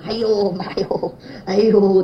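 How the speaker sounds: drawn-out hammed-up cry of pain, slow; mock groan for mother. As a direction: groan it like the old man in the hospital bed, slowly. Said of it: "他在那喊妈". (慢慢地，夸张地模仿老人喊疼)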